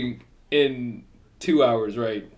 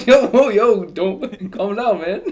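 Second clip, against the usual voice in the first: high, loud laughing plea to calm down; 7 semitones higher, 6 dB louder, slower than usual. Real, not acted. Cohost laughing while telling his friend to calm down, high and loud. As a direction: laugh through it, much higher and louder.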